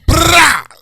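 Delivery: loud rolled "brrah" burst; rapper gun ad-lib. Retold or imitated. Riffing on shooting the club up, rapper gun ad-lib. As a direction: imitate a rapper's gunfire shout. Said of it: "what rapper be saying that".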